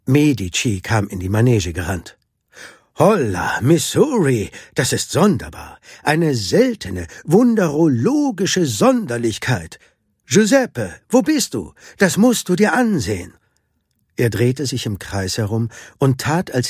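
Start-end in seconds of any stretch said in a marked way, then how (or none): 3.0-13.3 high-pitched, swooping theatrical wonder; showman's amazement. A narrator's reading. Medici calling his brother to see, showy amazement. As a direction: build from marvelling to a high excited call.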